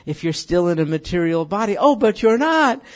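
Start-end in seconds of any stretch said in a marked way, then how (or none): 1.8-2.8 high, loud exclamation; excited exclaim. Real, not acted. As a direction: burst out in joy. Exclaimed rebuttal in prayer.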